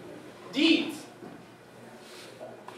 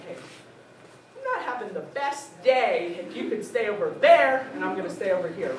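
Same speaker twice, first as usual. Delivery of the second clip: slow, deliberate warning with growing edge; firmer, slowed down; 2 semitones lower than usual. Retold or imitated. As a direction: spell it out slowly, patience wearing thin. Asked again after more bother.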